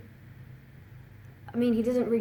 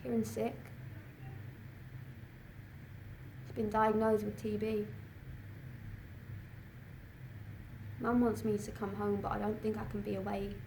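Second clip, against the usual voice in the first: soft, withdrawn delivery; subdued; 12 dB quieter than usual. Acted. Student torn between sick Kieran and boyfriend, quietly subdued. Cut off from family, brother sick.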